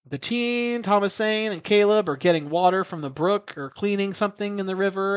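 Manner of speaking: ordinary talk, nothing marked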